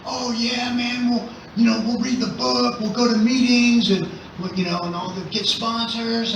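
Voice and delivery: high, eager, gushing; mimicking an overexcited buddy; imitating excited friend. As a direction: gush like a newly keen recoverer. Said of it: "he got all excited".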